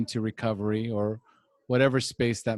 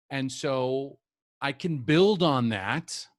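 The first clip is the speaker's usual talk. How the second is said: ordinary delivery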